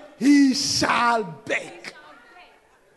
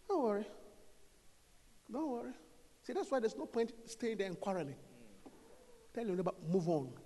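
quiet calm teaching; 3 semitones lower, 5 dB quieter than usual. Real, not acted.

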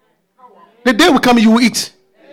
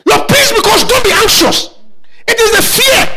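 fiery preaching; 7 semitones higher than usual. Acted. Preacher to anxious congregation, fiery shouted preaching. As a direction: preach it hard, voice rising and cracking with urgency.

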